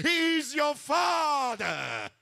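high, crying shout, slowing on the second line; preaching shout. Real, not acted. Preacher to his congregation, a crying shout. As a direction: cry it out to the whole church.

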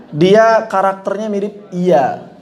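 ordinary delivery

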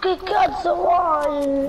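high, drawn-out tearful retort; crying. Real, not acted. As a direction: answer through tears, slow and high. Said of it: "ma perché stai piangendo".

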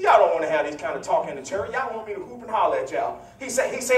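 usual speaking voice

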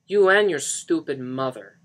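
loud, indignant outburst; angry insult. Acted. Johnny insults Lisa and her mother, angry and loud. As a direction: throw the insult out loudly.

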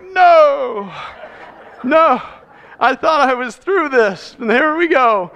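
exasperated pastor inner voice; mock dismay. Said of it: "I'm like".

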